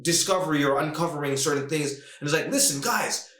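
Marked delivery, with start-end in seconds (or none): 2.5-3.3 higher-pitched, eager mock plea; pleading appeal. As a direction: plead with them in a raised voice. Retells Rogan's plea to secret society.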